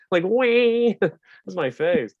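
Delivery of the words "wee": high, loud harmonica-like wee; a buzzy high-pitched squeal; shrill squeak. Shown as a demonstration. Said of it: "like if a harmonica complained".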